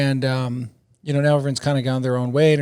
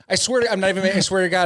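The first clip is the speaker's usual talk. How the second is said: ordinary talk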